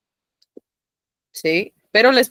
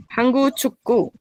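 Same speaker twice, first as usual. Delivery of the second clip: "Hanguk chukku" in two beats, stopping after "Hanguk"; "Hanguk chukku" as two separate two-syllable units, pitched up; pause on second syllable; 6 semitones higher than usual. Shown as a demonstration. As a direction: halt briefly on each word's second syllable in "Hanguk chukku". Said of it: "siempre tengo que parar así".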